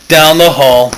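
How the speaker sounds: plain reading voice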